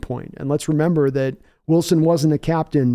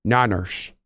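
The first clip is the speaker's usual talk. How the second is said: Liam Neeson impression; 5 semitones lower, 3 dB quieter than usual. Streamer repeating the Liam Neeson bananas impression for chat. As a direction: lower one-word Liam Neeson take again. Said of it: "So this is Liam Neeson saying bananas".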